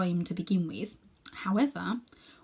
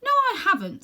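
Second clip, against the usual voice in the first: higher, louder indignant denial; 13 semitones higher, 7 dB louder than usual. Acted. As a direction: protest the accusation hotly. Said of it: "said Diggory indignantly".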